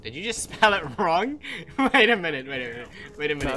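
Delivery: high, loud and laughing; loud incredulous laughter through the words; laughing disbelief. Real, not acted. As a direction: crack up while calling out a mistake. Laughing over a suspected misspelling.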